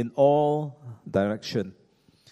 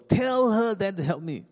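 exclaiming complaint; 7 semitones higher than usual. Acted. Martha complaining about her sister to the Lord, exclaiming. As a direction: let the stress burst out as a demand. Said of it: "She exclaimed".

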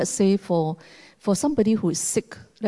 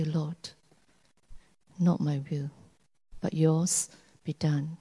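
quiet surrender; 4 semitones lower, 7 dB quieter than usual. Acted. Giving up in prayer.

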